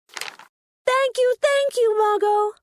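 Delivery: Rolly thanking Muggo, overjoyed; cheering. Acted. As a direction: burst out thanking your friend. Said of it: "Rolly cheered".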